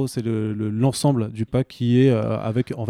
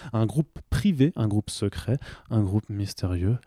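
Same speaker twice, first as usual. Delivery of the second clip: suave voice; 2 semitones lower, 4 dB quieter, slower than usual. Pretend. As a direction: purr it like a mysterious secret. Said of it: "Et je prends une voix suave".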